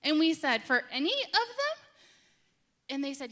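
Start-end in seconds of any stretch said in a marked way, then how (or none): 0.6-1.9 high, incredulous question; can't believe every kid is turned away; shocked disbelief. Retold or imitated. Parent at the check-in desk, stunned and incredulous.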